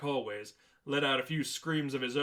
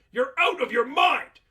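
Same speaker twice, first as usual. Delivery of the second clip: high, loud outraged yell; furious shout; 13 semitones higher, 11 dB louder than usual. Acted. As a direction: scream that he is insane. Manager yells at Dante.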